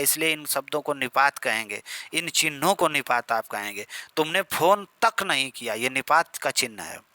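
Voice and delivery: ordinary talk, nothing marked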